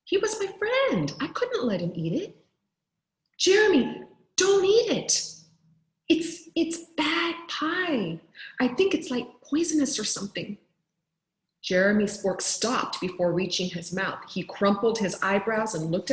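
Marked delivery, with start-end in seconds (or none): 3.4-10.6 high, urgent panicked warning; panicked plea. Acted. Boy panicking, warning his friend off the pie. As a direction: blurt it out in a panic, much higher. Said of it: "It was at this point that I panicked".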